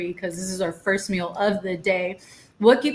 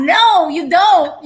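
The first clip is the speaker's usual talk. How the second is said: slow, loud and much higher; emphatic no; 8 semitones higher, 9 dB louder, much slower than usual. Real, not acted. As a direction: draw out a firm, loud no. Host firmly insists.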